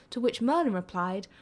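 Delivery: ordinary delivery, nothing marked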